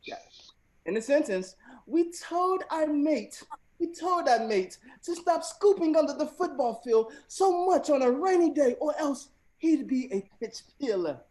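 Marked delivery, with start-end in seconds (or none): 1.9-11.2 high, loud, slowish British accent. Shown as a demonstration. Host reading the slang sentence again in a loud, high British accent. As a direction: ham up a British accent for the game. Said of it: "I'm trying to tell the British accent".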